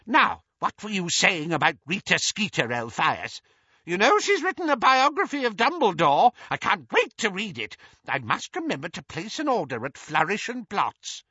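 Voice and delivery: put-on high, eager old busybody; high-pitched elderly lady; gossiping old aunt. A narrator's reading. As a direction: gossip keenly in a shrill old voice. Muriel gossips.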